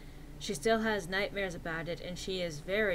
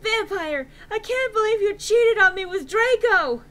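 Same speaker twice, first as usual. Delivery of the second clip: high loud accusing shout; overacted jealous yelling; hammy stage actor; 13 semitones higher, 11 dB louder than usual. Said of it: "I shouted at him".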